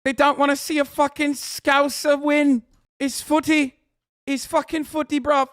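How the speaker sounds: high, loud mocking English lad accent. Mocking Man United fans, in a hammed-up English lad accent. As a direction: ham up an angry English football lad accent.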